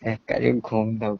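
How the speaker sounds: normal talking voice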